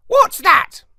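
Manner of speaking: high, loud squawking parrot. A narrator's reading. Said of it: "he squawked".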